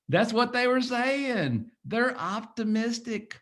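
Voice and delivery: slow and high, stretching each word; slow, high emphasis; drawn out. Real, not acted. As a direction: spell it out slowly and lifted.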